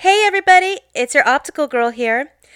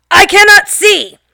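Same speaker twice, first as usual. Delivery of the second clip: much higher, louder slow yell; 10 semitones higher, 10 dB louder than usual. Retold or imitated. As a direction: bellow it in outrage. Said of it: "He yells very loudly".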